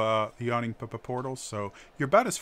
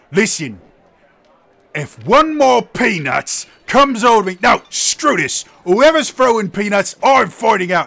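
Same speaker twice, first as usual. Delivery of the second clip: furious bellow, much higher and louder; angry shout; 10 semitones higher, 13 dB louder than usual. Acted. Brawny warrior bellows at the tavern crowd, furious shout. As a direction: roar at the whole tavern in rage. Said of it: "guy with a greatsword go".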